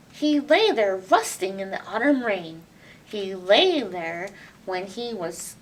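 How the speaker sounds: ordinary delivery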